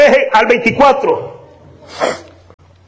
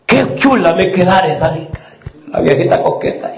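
mock old lady; 5 semitones lower, 3 dB quieter than usual. (una viejita coqueta, imitada con burla suave)

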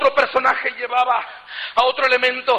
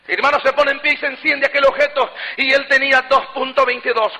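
normal talking voice

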